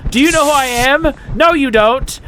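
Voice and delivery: high, indignant mock rant; hammed-up villainous indignation; mock outrage. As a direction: scold them in pretend fury.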